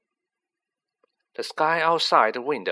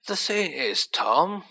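sighing; 6 semitones higher, slower than usual. A narrator's reading. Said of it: "he sighed".

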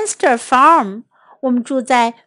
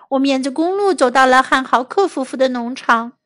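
usual speaking voice

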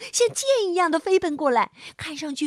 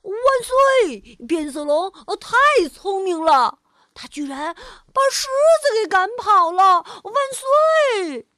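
high, loud cheer; monkey cheering loudly; 5 semitones higher, 6 dB louder than usual. Acted. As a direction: shout hooray with joy. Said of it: "大笑着说".

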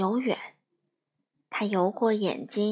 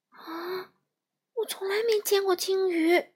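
amazed; 8 semitones higher than usual. Acted. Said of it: "闪闪惊奇地说".

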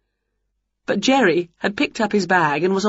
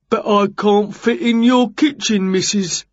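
builder Jerry, objecting; 3 semitones higher than usual. A narrator's reading. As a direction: protest as a big builder would.

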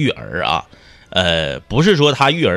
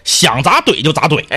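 ordinary delivery

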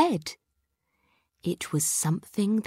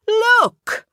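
high, loud, triumphant "Look!"; spring the surprise with glee; gleeful; 7 dB louder than usual. A narrator's reading. Kipper to Dad, springing the trick, gleeful.

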